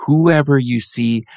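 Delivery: ordinary talk, nothing marked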